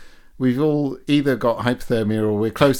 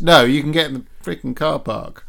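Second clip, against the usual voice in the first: mock sarcasm; 5 semitones higher, 6 dB louder than usual. Mocking English welcome.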